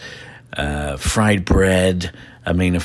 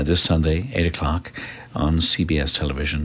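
ordinary delivery

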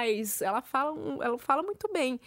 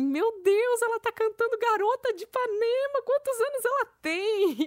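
high, stunned gasping voice; shocked mothers; 11 semitones higher, 3 dB louder than usual. Retold or imitated. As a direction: play the astonished moms at the pool. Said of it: "as mães ficaram em choque".

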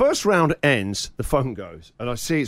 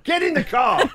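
angry bark. Retold or imitated. Dad orders the kids into the car, mock angry and much higher. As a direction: snap it at the kids in public.

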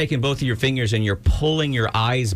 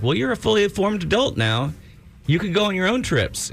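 mocking a parent, much higher; a hammed-up lecturing parent, pitched way up; 9 semitones higher than usual. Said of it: "they'll go like".

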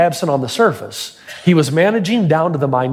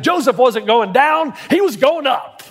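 higher, drawn-out preacher's punchline; fiery preacher's climax; 5 semitones higher, slower than usual. Retold or imitated. Pastor imitating a preacher stamping his foot on the payoff, triumphant and emphatic. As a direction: land the imitated sermon's climax, pitch lifting at the end. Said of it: "put his foot on the ground and said".

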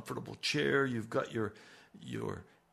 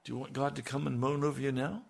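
ordinary talk, nothing marked

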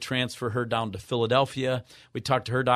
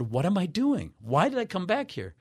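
puzzled self-question; 8 semitones higher than usual. Retold or imitated. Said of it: "I walked back there and said".

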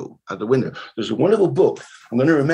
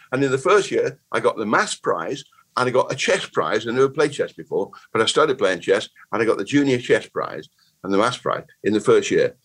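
ordinary talk

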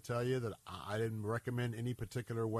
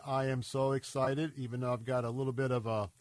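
normal talking voice

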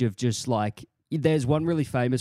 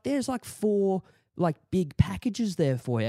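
chatty landlady; 7 semitones higher than usual. Retold or imitated. Voicing landlord.

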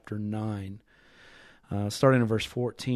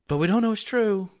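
much higher, wavering over "don't know"; doubting counterpoint; 9 semitones higher, 5 dB louder than usual. Acted. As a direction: lift "we don't know it's true" high in doubt. Voices the believer's doubting side.

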